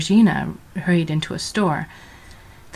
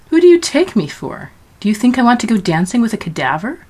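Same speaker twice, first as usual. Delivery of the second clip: high, loud indignant retort; indignant outburst; 5 semitones higher, 5 dB louder than usual. A narrator's reading. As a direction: throw the offer back at him. Georgina indignant at Death.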